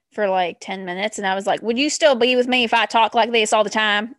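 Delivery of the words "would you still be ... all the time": loud, put-on Southern accent; deep Southern accent. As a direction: lay the Southern accent on thick. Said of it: "talking in a fucking deep Southern accent".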